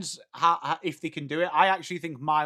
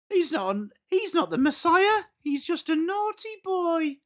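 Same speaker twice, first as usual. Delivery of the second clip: very high-pitched comic character voice; comic film quote. Acted. Streamer quoting a comedy film to chat, high put-on voice. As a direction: squeal it like a scolding comedy character.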